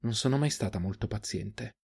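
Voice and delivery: soft, understated murmur. A narrator's reading. Huntress to the man she killed, quiet murmur. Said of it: "mormorò la ragazza al morto".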